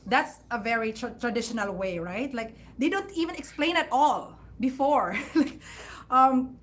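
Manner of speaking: ordinary talk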